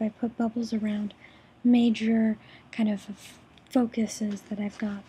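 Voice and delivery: ordinary talk, nothing marked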